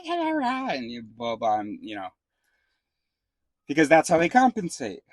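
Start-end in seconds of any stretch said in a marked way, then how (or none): 0.0-0.7 much higher, yappy little dog imitation; yapping little dog. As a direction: yap it out like a tiny dog showing off. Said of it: "They'll go".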